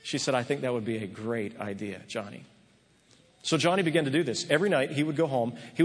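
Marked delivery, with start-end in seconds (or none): 0.4-2.4 soft, low and tender approval; gentle reassurance. Retold or imitated. Speaker telling Johnny his idea is great, soft and tender. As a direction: answer him gently, like praising a proud young man.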